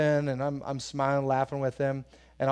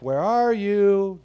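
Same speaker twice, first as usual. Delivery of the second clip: slow, higher playful calling out; mock searching call; 6 semitones higher, 7 dB louder, much slower than usual. Dad pretending to search for a hiding boy, slow playful call. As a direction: stretch it out like you can't see him. Mock seeking a child in hide and seek.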